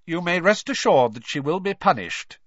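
cold, emphatic pronouncement. A narrator's reading. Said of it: "Mister Crouch added coldly".

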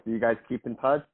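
usual speaking voice

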